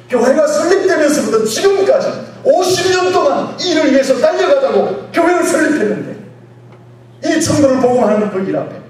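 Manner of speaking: loud, high-pitched sermon delivery; full-force preaching. Real, not acted. Preacher declaring obedience to the congregation, full-force preaching. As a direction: drive each point home at shouting force.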